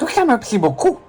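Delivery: high, slobbering nerd voice. Acted. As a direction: talk through drool like a nerd. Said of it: "la voix va être un peu aléatoire avec la bave".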